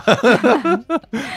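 loud high-pitched burst of laughter. Real, not acted.

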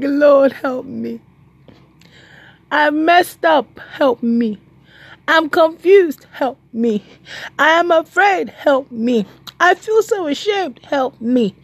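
high, slow pleading to God; pleading prayer. Retold or imitated. Believer crying out to God, high and pleading. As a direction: cry out to the Lord in need.

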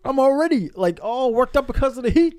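high, loud, worked-up rant; hammed-up hot-yoga frustration; frustrated instructor. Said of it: "I'm frustrated".

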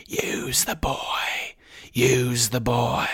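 very slow, ghostly whisper; eerie voice. Acted. Voldemort's hidden voice whispering to Quirrell, very slow. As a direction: breathe it out like a hidden spirit. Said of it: "a voice answered".

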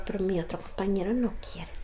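officer whispering that his partner refuses; retold whisper. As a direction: breathe it like a confidential warning. Officer confides about his partner.

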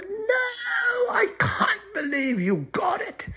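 very high drawn-out wail; squealing troll tantrum; anguished troll. Acted. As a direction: wail in hammed-up disbelief. Troll loses final riddle.

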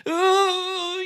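very high, loud squeal of disgust; disgusted yelp. Acted. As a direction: shriek as bugs crawl on you. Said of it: "you yell".

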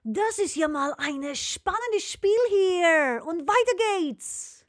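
slow, drawn-out host announcement; slow announcer voice. Acted. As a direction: present it like a game show host. Moderator-like announcing.

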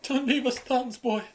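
high, desperate cry for help; pleading NPC. Acted. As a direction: beg him not to leave, high-pitched. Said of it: "they're like".

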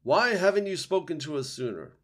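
high, loud demand from the treetop; owl screech. A narrator's reading. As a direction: screech the question down accusingly. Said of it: "screeched the owl from higher up".

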